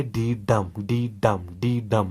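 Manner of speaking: "डी डम" chanted in even two-beat pairs; iambic beat. Shown as a demonstration. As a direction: sound out "डी डम" as a repeated rhythmic foot. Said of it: "जिसको मतलब आवाज़ निकलती है".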